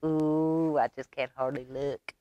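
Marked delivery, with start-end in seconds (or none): none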